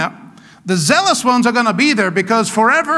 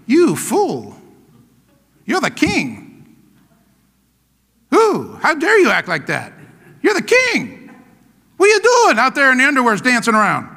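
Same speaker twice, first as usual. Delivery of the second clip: scolding wife; 3 semitones higher than usual. Acted. Wife berating dancing King David, mock indignant scolding. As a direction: scold him in mock outrage, swooping up. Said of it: "what does his wife say?".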